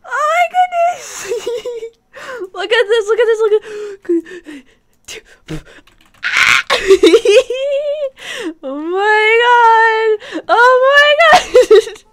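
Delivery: laughing shrieking joy; ecstatic squeal. Real, not acted. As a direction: scream with delight. Overjoyed at new pet.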